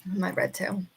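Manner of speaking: ordinary talk, nothing marked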